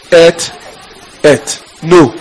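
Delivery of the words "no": usual speaking voice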